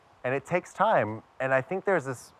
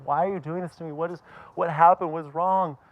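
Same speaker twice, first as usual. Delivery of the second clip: mild mock of a distressed mother, higher; imitating upset mom; 6 semitones higher than usual. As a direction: plead like the hurt mother, lightly. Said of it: "she starts crying".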